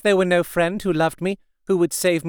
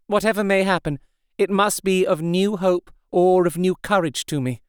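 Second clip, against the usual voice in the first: a touch of sorrowful resolve, higher; lightly voiced brave despair; 6 semitones higher than usual. A narrator's reading. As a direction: Mina telling the men to hide nothing. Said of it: "so brave, and yet so sorrowful".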